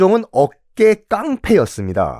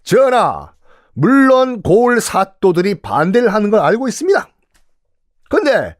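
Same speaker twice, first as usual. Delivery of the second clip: high, urgent remonstrance with pointed questions; impassioned minister; 6 semitones higher than usual. Retold or imitated. Kim Yuk arguing passionately before the king. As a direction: press the king hard, rhetorically. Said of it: "김육이 한 말이에요".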